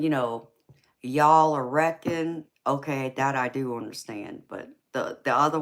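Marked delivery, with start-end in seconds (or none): 1.0-2.4 Southern words. Shown as a demonstration. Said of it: "Now saying".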